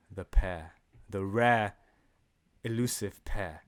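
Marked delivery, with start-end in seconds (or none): none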